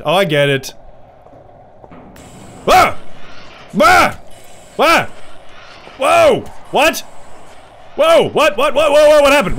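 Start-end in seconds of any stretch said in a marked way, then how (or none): 2.7-5.0 startled yelps. Real, not acted. Sudden scare in horror game. 6.0-9.6 startled confusion. Real, not acted. Confused by sudden game event.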